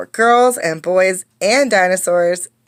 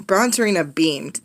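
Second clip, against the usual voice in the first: plain reading voice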